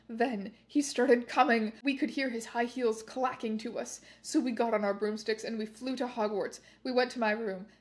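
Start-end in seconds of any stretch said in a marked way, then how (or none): none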